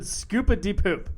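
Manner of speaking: high, slow, hammed-up lyric; mock [name] quote. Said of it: "He said".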